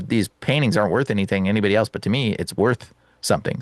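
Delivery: ordinary delivery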